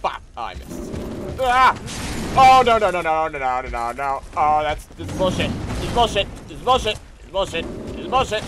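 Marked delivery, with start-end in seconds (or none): 1.4-4.2 loud high panic fading down; panicked no's. Real, not acted. Gamer panicking as an enemy beats him, fading. 4.4-8.4 loud repeated complaint; angry rant. Real, not acted. Gamer complaining to the game, angrily.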